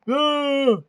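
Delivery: loud, high ah; short shriek of glee; excited shriek. Real, not acted. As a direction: let out a sudden delighted yelp. Reacting to clip.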